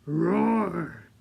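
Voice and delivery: a loud growling roar; lion roar. Pretend. Teacher showing kids a lion's loud roar. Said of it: "what sound did we say a lion makes".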